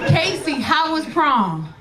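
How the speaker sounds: drawn-out slow ask; slow question. Retold or imitated. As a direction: ease the question out slowly. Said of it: "she talk with her whole body".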